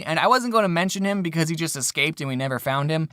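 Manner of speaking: ordinary talk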